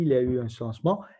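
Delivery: ordinary talk, nothing marked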